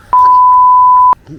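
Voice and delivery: high, slow drawn-out scream. Real, not acted. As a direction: stretch the scream out long. Fish still fighting.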